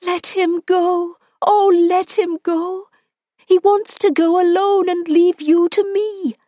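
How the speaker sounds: woman clinging to him, imploring; passionate plea. Acted. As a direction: beg him to let go. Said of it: "prayed him passionately".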